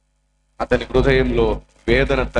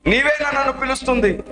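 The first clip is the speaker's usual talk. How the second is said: eager calling; 11 semitones higher than usual. Retold or imitated. Boy startled, calling unseen voice.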